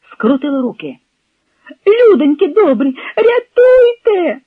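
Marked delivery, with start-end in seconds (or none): 1.9-4.4 high, loud, drawn-out scream for rescue; panicked wail, last word stretched; cry for help. A narrator's reading. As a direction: call out desperately to anyone nearby. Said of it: "закричала Зося".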